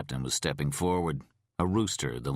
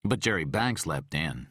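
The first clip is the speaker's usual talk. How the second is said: plain reading voice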